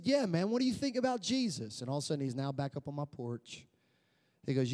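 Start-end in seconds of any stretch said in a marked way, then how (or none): 0.0-1.8 high, insistent acted question; eager repeated question. Customer pressing delivery man about Jesus, eager and high.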